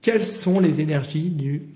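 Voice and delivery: usual speaking voice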